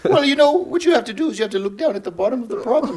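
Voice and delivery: comic academic voice, pitched high; Jordan Peterson. Acted. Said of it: "I've been working on that impression".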